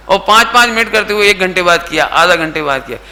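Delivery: ordinary talk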